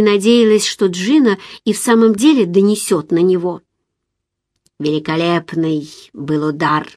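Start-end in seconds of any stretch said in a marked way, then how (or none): 4.8-6.9 low, understated dark pride; grimly proud. A narrator's reading. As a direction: savour it grimly, pitched down. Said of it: "с мрачной гордостью". (с мрачной гордостью, низким голосом)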